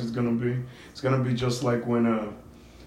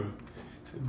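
ordinary talk, nothing marked

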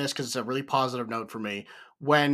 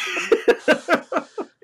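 high laugh; 3 dB louder than usual. Real, not acted. Laughs after the dad quote.

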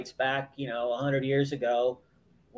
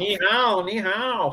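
Chinese greeting said higher, in a joking put-on accent; mock Chinese greeting; 6 semitones higher, much slower than usual. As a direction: playfully fake a Chinese accent. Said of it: "Watch, watch the accent".

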